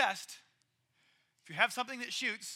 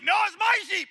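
much higher and louder, flatly refusing; defiant shout; 8 semitones higher, 10 dB louder than usual. Acted. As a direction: stand up to the lion with a yell. Boy shouts at lion.

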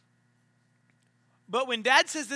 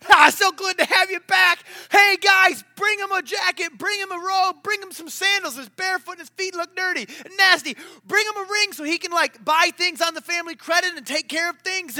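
high, loud joyful dad calling to servants; overjoyed shout; 8 semitones higher, 10 dB louder than usual. Retold or imitated. Dad welcoming lost son home, joyful loud shouting. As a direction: shout for joy, calling orders.

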